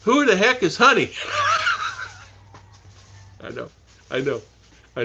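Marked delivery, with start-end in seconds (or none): 0.0-1.1 higher, louder mock outrage through a laugh; indignant wife. Wife demanding who Honey is, mock indignant shout. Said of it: "Then she says". 1.1-2.1 high, bursting laugh; big laugh. Real, not acted. Laughing at wife's jealous question.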